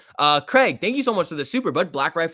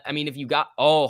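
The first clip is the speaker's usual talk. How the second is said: normal talking voice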